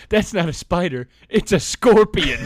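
loud, high, laughing through the words; laughing loud retelling. Retold or imitated. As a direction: blurt it out while cracking up. Retelling Nathan's alarming news.

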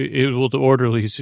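usual speaking voice

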